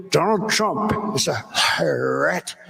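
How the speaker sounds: slow, halting imitation of an opponent; mocking Biden. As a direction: drag it out, stumbling onto the last word. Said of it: "He always says".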